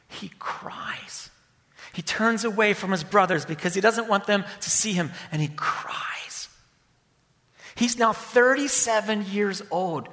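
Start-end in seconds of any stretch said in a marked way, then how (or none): 0.1-1.3 whispered, no voice; hushed whisper to the congregation. A narrator's reading. Pastor describes Joseph weeping. 5.3-6.5 whispered, no voice; hushed whisper to the congregation. A narrator's reading. Pastor describes Joseph weeping.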